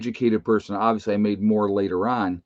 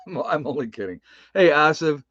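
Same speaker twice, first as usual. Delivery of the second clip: normal talking voice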